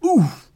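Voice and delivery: high, loud "oof"; oof of impact. Acted. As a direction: grunt from a kick in the belly. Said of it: "heard an".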